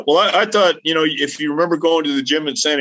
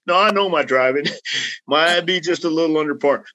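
ordinary talk, nothing marked

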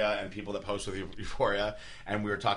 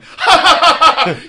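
high, loud derisive cackle; mocking loud laugh; 17 dB louder than usual. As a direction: burst into exaggerated mocking laughter. Prankster's mocking laugh acted out.

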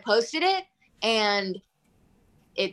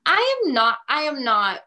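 mock indignant shut-down; sassy retort; 7 semitones higher than usual. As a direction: snap back with attitude. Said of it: "and I was like".

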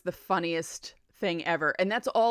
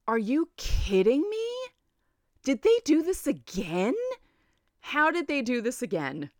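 can't-believe-it retelling, raised and emphatic; incredulous disbelief; 6 semitones higher than usual. Retold or imitated. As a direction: re-enact stunned disbelief, pitch climbing on each question.

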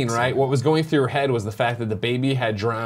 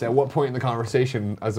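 ordinary talk

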